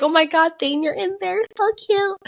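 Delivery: high-pitched squeal of affection; gushing coo. Retold or imitated. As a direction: coo at a baby in a high little voice. Cooing at baby in photo.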